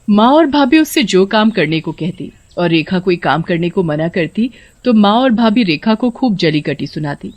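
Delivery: ordinary delivery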